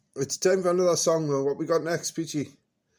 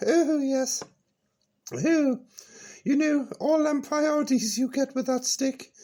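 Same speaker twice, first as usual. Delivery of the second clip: put-on high, bright-eyed old dear reminiscing; high character voice Peachy; 9 semitones higher than usual. Old character reminiscing to the radio host, high voice.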